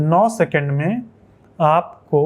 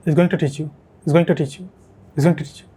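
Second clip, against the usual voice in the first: linked native English accent, TO as "tuh"; imitated native accent; slower than usual. Shown as a demonstration. Teacher drilling for students, connected native English accent. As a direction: repeat it in a run-together native English accent. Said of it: "कुछ इस तरह से".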